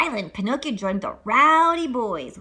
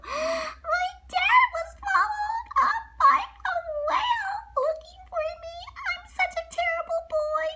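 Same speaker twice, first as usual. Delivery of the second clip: wailing sob. Acted. Pinocchio learning his dad was swallowed, high sobbing wail. Pinocchio wails over father.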